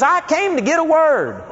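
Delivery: high, laughing mock whine; mock petulant whine; upset woman. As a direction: imitate her huffy complaint. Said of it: "She was upset".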